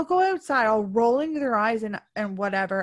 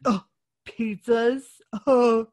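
mock dad; 4 dB louder, slower than usual. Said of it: "and the dad's like".

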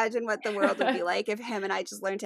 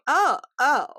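higher, louder "oh" of realization; dawning realization; 5 semitones higher, 7 dB louder than usual. Retold or imitated. Retelling her moment of realization to a friend, dawning realization. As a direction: act out the penny dropping.

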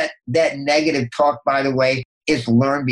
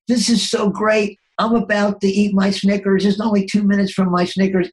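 giddy excitement; 6 semitones higher than usual. Retold or imitated. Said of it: "And I'm thinking".

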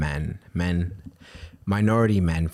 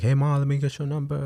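higher, a hint of a street catcall; mock catcall; 8 semitones higher than usual. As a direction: lightly play the guy hitting on her. Imitating the delivery guy's catcall.

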